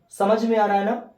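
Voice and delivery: ordinary delivery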